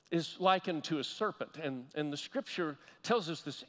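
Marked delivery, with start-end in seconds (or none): none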